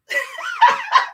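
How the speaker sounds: high burst of laughter; a quick giggle. Real, not acted. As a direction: laugh at the joke. Laughing at own joke.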